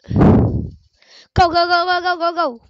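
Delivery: loud, rising chant of go; excited urging. Real, not acted. Urging the game character on.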